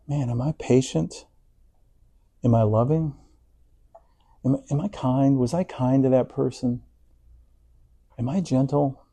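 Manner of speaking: soft, searching self-questions; inner questioning. Retold or imitated. Believer checking his own heart, soft and questioning. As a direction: ask yourself quietly, half aloud. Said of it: "how much do we think".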